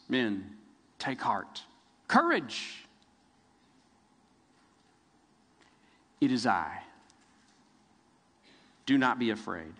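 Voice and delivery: soft, low, calming reassurance; comforting Jesus. Acted. Jesus calming his panicked disciples on the water, soft and reassuring. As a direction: hush the frightened men gently. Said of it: "quick to calm them, quick to comfort them".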